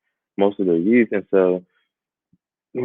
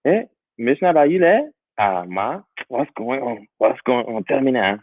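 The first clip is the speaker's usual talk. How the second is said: Liberian English with friends; 4 semitones higher than usual. Shown as a demonstration. Talking to a friend, switches into a Liberian English accent. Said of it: "I'm like".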